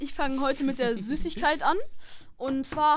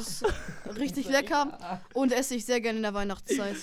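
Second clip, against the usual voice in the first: ordinary delivery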